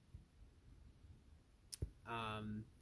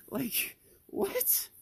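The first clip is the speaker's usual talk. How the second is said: incredulous; 5 dB louder than usual. Real, not acted. Incredulous at the boss.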